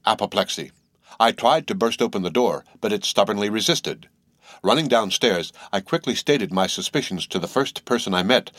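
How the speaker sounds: plain reading voice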